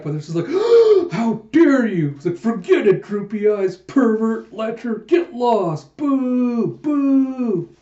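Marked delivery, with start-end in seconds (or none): 0.5-1.1 loud, high theatrical gasp; shocked gasp. Acted. Pirates taken aback by their comrade's remark, shocked gasp. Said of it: "they're all just taken aback". 1.1-2.1 high, loud, slow mock outrage; taken aback, outraged. Pirates taken aback by an insult, hammed-up outrage. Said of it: "they're all just taken aback". 2.4-7.7 high, loud mock jeering; indignant heckling. Offended pirates booing their crewmate, hammed-up indignation.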